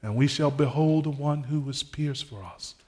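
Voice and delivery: ordinary talk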